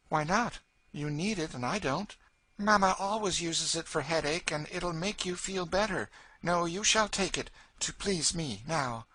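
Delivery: put-on light high girl's voice; a sweet, eager child; small girl offering a gift, high and earnest; little Eva. A narrator's reading.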